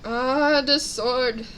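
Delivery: ordinary delivery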